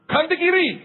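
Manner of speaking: high, bossy instruction; someone instructing. Retold or imitated. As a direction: order the direction casually. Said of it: "Ada orang berkata". (orang memberi perintah dengan nada tinggi)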